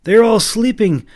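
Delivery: high loud urgent exclaim. A narrator's reading. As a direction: cry it out in alarm. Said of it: "Nathan exclaimed".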